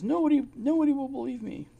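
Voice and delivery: high, strained voice of a despairing woman; a mock wail of despair; desperate woman quoted. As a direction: play her as helpless and high-pitched. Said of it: "She says".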